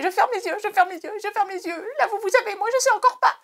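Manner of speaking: voix bien plus aiguë, impatience enfantine; trépigner avant d'ouvrir le cadeau; surexcitée. Real, not acted. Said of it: "je suis surexcitée". (ouvre le cadeau surexcitée, voix très aiguë, jusqu'au cri final)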